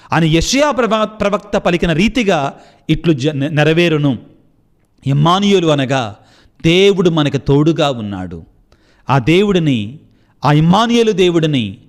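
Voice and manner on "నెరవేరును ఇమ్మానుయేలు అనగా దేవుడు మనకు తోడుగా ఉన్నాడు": ordinary talk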